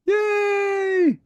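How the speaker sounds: very high Yay; a whole crowd cheering. Acted. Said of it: "cheered the crowd".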